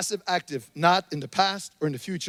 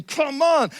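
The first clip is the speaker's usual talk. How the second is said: higher, exasperated cry; frustrated outburst; 10 semitones higher, 5 dB louder than usual. Retold or imitated. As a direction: vent the frustration at the lost passport. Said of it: "I'm getting angry".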